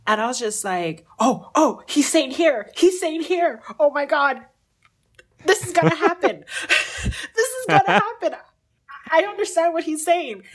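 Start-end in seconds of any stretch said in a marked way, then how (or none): 1.2-10.4 high, loud, squealing thrill; overjoyed, pitch climbing as it sinks in; giddy excitement. Retold or imitated. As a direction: re-enact an ecstatic breakthrough at the top of your voice. Retold thrill at understanding Chinese.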